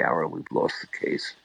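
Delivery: hushed whisper to a colleague; whispering to Alan as the judge speaks; whispered aside. Retold or imitated. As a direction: lean over and whisper it.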